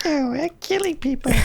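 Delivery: very high squeaky murmur; broken adolescent voice. Retold or imitated. Host playing young John amid laughter, broken squeak. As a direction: squeak it like a pubescent boy. Squeaky film-character voice resumed.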